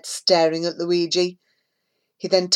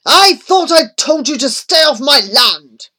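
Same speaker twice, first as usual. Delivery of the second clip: loud, higher angry bark of a menacing man; 8 semitones higher, 9 dB louder than usual. A narrator's reading. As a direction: snap it out like a threat. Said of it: "he barked".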